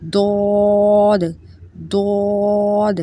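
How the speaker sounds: داد drawn out slowly, tongue edge at the molars; thick, slow داد; heavy dad. Shown as a demonstration. Teacher stretching out داد to show the tongue edges. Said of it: "داڑوں سے touch کریں گے تو داد ادا ہوگا".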